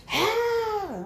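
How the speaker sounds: high shrill imitated scream; mock scream. Mimicking the lady screaming at the funeral. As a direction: let out a sudden high scream. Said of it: "and she's screaming".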